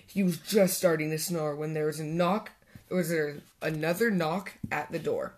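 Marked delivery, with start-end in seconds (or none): none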